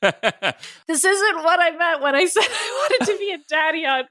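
high-pitched protesting cry. A narrator's reading. Ryan protests while being chased around, crying out. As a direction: cry out in comic dismay. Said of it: "Ryan cried out".

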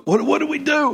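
high-pitched, stammered mock panic. Elders ask Samuel what to do, mock panic.

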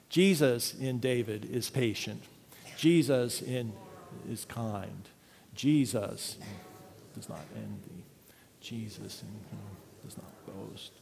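soft, low recitation sinking to breath; hushed reading fading to a whisper. Acted. Pastor leads a final prayerful reading, fading to a whisper. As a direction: let it fade into a whisper.

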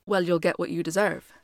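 ordinary delivery, nothing marked

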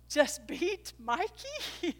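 can't believe what they saw; disbelief. Retold or imitated. Kids amazed their dad won, slow and stunned. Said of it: "the kids are all like".

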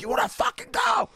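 high, shouted fighting challenge; crazed challenge. Retold or imitated. Facing down a crackhead gang, shouting a crazed challenge. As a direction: pick a fight you can't lose. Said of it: "I just went crazy".

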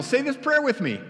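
high and pushy, leaning on "prayer"; eager evangelist. Acted. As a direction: sell the prayer like a cheery pitchman.

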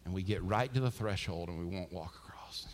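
low, hushed near-whisper; hushed preaching. Real, not acted. Preacher lamenting that we won't walk across, hushed. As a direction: drop to a grave, confiding hush.

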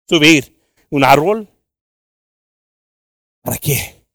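ordinary talk, nothing marked

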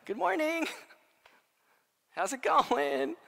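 high, drawn-out comic greeting; playfully hammed-up casual hello; nonchalant Jesus. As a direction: say hello as if it's any ordinary day. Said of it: "it's just so nonchalant".

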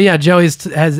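ordinary delivery

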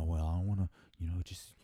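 low, softened reluctant mumble, imitating closeted guys; reluctant excuse. Man imitating guys who hide their relationships, reluctant.

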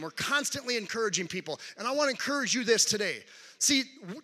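ordinary talk, nothing marked

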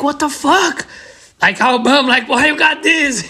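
high, incredulous disbelief, slowing at the end; stunned friend. Retold or imitated. Roommate baffled by the pile of bearings, high incredulous voice.

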